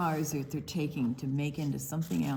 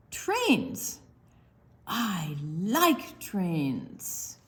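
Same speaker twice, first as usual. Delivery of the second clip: sing-song, drawn-out picture book reading; reading to children; 2 semitones higher, 5 dB louder, much slower than usual. Acted. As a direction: read it slow and bouncy for little ones. Reads picture book aloud.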